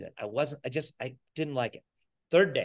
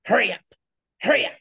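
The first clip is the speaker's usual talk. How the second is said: imitating a manager's high, louder "hurry up"; bossy manager; 8 semitones higher, 6 dB louder, much faster than usual. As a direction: bark out "hurry up" like an impatient boss. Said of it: "the manager walked by and said".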